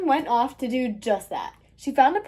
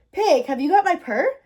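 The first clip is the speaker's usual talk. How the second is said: higher, raised calling question; calling over noise; 6 semitones higher, 5 dB louder than usual. A narrator's reading. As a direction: call out to be heard over the piglets. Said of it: "she called over the snuffling".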